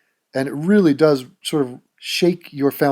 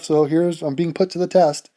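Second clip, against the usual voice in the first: ordinary talk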